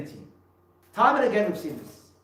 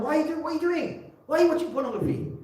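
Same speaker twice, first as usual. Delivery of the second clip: higher, alarmed questioning of her son; shocked mother; 10 semitones higher, faster than usual. Retold or imitated. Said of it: "I said to my son".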